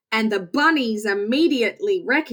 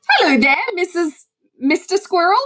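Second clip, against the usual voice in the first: higher, louder friendly call; cheerful skunk greeting; 8 semitones higher, 5 dB louder than usual. Acted. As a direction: hail the squirrel brightly. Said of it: "Fred greets squirrel".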